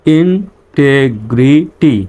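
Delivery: very slow "integrity", a gap after each syllable; teacher's slow sounding-out. Shown as a demonstration. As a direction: break "integrity" into in-te-gri-ty. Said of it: "reading পড়ে আসি".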